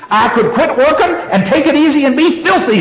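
high-pitched, mildly growled threat. A narrator's reading. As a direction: read a defiant threat, pitched well up. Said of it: "she growled".

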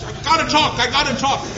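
loud, high urgent plea to speak; eager shouting. Retold or imitated. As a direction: push it out like a student desperate for the floor.

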